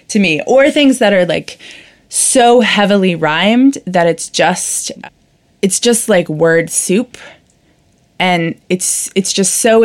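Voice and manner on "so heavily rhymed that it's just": usual speaking voice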